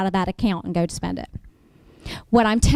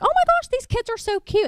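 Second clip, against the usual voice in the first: much higher cooing voice; gushing over kids; 13 semitones higher than usual. Retold or imitated. Attorney cooing over kids in her waiting room. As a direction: coo over the cute kids.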